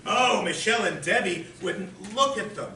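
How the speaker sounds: high, loud imitation of earnest protest; missing the joke. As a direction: object loudly, taking the joke literally. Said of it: "Ted doesn't get the joke".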